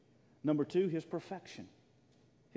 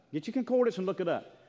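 ordinary talk

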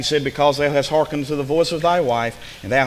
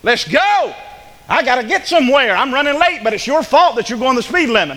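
higher, louder impatient complaining; impatient driver; 7 semitones higher, 5 dB louder than usual. Retold or imitated. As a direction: hurry them along, fed up. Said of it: "you get impatient".

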